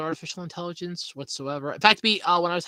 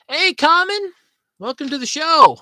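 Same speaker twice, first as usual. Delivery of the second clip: excited greeting; 11 semitones higher, 10 dB louder than usual. Real, not acted. Greeting the chat viewer loudly.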